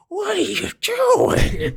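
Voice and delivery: very high, drawn-out mock whine; exasperated disbelief. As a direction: drag it out high in mock despair. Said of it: "makes me just irrationally angry".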